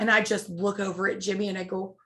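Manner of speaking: ordinary talk, nothing marked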